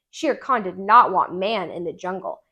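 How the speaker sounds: ordinary delivery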